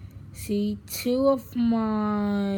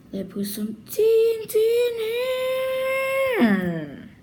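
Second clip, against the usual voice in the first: excited; 7 dB louder, faster than usual. Real, not acted. Excited fast reaction.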